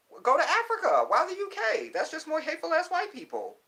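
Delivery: very high, loud exclamation; squealed-up, loud objection; loud, high-pitched objection. Real, not acted.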